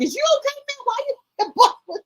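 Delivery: put-on high, teasing kid voice, squeaky and cracking; mock the friend in a high squeal; imitating the friend. Son's friend taunting him on the bus, high mock voice. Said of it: "His friend was like".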